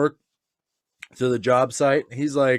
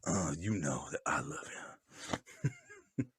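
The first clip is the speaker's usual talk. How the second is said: mock tender shout-out; 9 semitones lower, 8 dB quieter than usual. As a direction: croon it like a radio love note.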